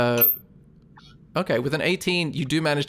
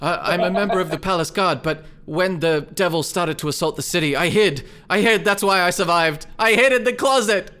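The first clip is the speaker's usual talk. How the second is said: rising, louder frightened confession; panicked confession; 5 semitones higher, 4 dB louder than usual. Acted. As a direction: blurt it out, getting more desperate. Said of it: "he says".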